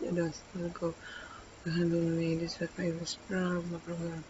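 mumbled lyric skim. Acted. Skimming the lyrics again to herself, in a fast soft mumble. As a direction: rattle off the lyrics under your breath.

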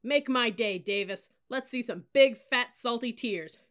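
loud, high menacing dare; in-your-face drill-sergeant jeer; goading taunt. A narrator's reading. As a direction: dare the rookie to cry. Officer goading her to cry.

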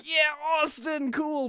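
mock fan excitement, high and slow; excited fan. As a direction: cheer like a fan seeing Austin return. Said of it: "And I'm like".

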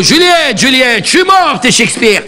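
usual speaking voice

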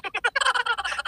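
sudden high burst of laughter. Real, not acted. Laughing at the sound setup.

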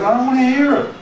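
wife cutting off husband's fears, mock high-pitched protest; retold protest. Wife suddenly stops her husband's worrying, imitated high protest.

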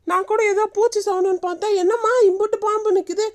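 high, frantic exclamations; alarmed panic. Acted. Grandmother sees many snakes and urges running, panicked. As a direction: cry out as danger closes in.

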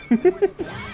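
short, high giggle. Real, not acted. Player satisfied after the game fight, high giggle. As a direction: let out a quick high titter.

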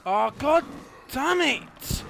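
very loud, high yelled curse; furious curse. Real, not acted. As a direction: let the rage explode. Player raging at game.

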